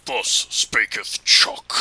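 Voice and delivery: low, very slow whispered pronouncement; mock prophet voice. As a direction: intone it like a prophet.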